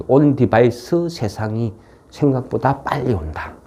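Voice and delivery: ordinary talk, nothing marked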